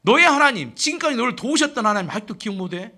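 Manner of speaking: loud, reproachful reminder; acted-out shouted self-rebuke; crying out to oneself. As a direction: call out forcefully as if jolting your own memory. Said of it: "다윗은 스스로에게 외칩니다".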